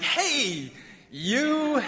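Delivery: hammed-up hello, pitched high on "you"; vague friendly greeting. Camp worker greets a half-remembered camper, mock-familiar. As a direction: cover for a forgotten name with a high, stretched greeting. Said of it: "I'd get really good at saying things like".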